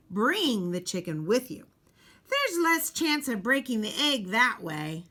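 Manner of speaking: normal talking voice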